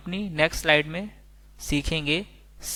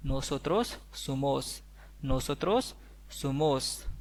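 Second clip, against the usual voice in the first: Nosotros somos drawn out slowly, syllable by syllable; slow Spanish; 5 dB quieter, much slower than usual. Shown as a demonstration. Teacher modelling Nosotros somos slowly for learners. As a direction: lay out each syllable slowly. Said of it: "तो हम बोलेंगे".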